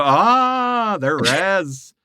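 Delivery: put-on sing-song reservation accent, rising high on Ah. Indian friend spotting another reservation Indian, sing-song reservation accent. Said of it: "if you hear Indian talking like this".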